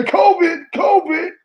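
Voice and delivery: covid said as a high sneeze, twice; mock sneeze covid. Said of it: "You can say".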